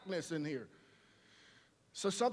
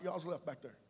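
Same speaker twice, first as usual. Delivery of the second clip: ordinary talk, nothing marked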